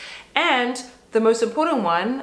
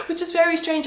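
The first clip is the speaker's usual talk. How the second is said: laughing; 10 semitones higher than usual. Real, not acted. Amused by her own lemon craving.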